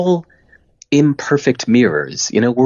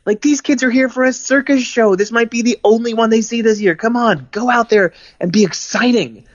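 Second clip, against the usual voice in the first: pushy boss; 11 semitones higher than usual. Retold or imitated. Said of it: "my boss would be like".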